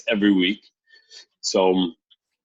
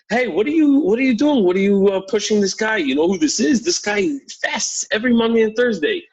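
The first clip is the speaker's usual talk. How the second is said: a protective follower telling off the pusher, indignant and raised; indignant scolding; 11 semitones higher than usual. Retold or imitated. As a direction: scold him for shoving a holy man.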